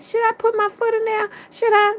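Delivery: very high, put-on questioning voice; mock naive pleading, way up high; high-pitched self-quote. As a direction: ask it in an exaggerated falsetto. Acts own question to livestream viewers.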